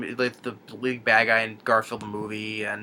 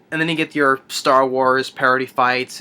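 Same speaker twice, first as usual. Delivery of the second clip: usual speaking voice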